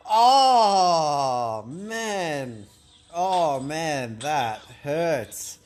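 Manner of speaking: loud drawn-out oh of pain, then a falling man; real pained groan at a big hit; pained cry. Real, not acted. Painful blow in the game.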